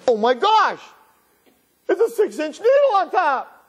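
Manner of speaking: high-pitched horrified outburst; a child's shrill panic at a scary sight; horrified shock. Acted. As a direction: gasp it out in wide-eyed alarm. Boy's shocked reaction in story.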